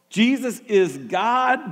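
slow, weighted "Jesus is God"; slow proclamation; emphatic. Acted. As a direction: lay each word down firmly. Preacher proclaims.

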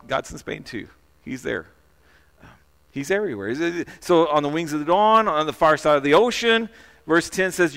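ordinary talk

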